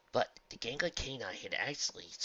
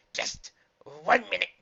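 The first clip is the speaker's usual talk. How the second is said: gagging creature voice; 9 semitones higher, 10 dB louder, slower than usual. Acted.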